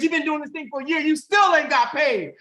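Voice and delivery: high, loud mock scolding; parent's nagging. Dad scolds the child for no pay, loud high mock scolding. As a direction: hammer the complaint home. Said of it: "dad says".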